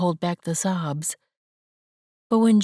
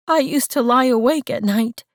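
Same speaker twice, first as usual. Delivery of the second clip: higher, louder tearful cry; 6 semitones higher, 6 dB louder than usual. Acted. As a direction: sob the words out. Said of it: "she hiccuped the words".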